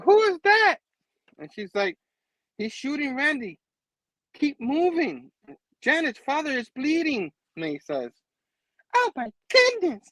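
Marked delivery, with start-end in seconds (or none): 0.0-0.8 very high, loud mock shriek; hammed-up startled cry; alarmed question. Acting the groom's alarm. 2.6-7.3 high-pitched imitation of a frightened woman; hammed-up high panic; mock panic. Acting May's panicked warning. 8.9-10.1 very high put-on shriek; hammed-up horrified falsetto; mock horror. Acting May's horror.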